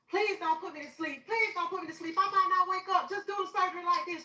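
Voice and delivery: high, desperate pleading; retold panicked high plea; stressed begging. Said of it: "now I'm stressing".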